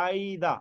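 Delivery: "either" with a stretched EYE and no r; British pronunciation. Shown as a demonstration. Teacher modelling British usage for students, "either" as EYE-da. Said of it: "British pronunciation".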